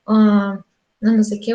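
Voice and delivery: ordinary talk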